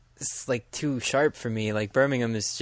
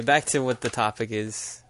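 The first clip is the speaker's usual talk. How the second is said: ordinary delivery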